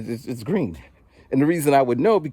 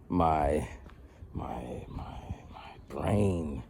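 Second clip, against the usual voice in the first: trailing whisper; 6 semitones lower, 11 dB quieter, much slower than usual. Real, not acted.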